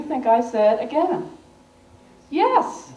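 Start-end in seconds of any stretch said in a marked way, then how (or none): 2.3-2.9 high, bright burst of yes; retold eager "Yes", pitched up. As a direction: jump at the chance.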